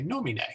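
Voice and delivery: NOMINE as NOH-mi-nay; long stressed first vowel. Shown as a demonstration. Teacher summing up the rule, "nomine" stressed on NO. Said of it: "that vowel is long. So we say".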